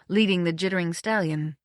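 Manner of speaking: ordinary delivery, nothing marked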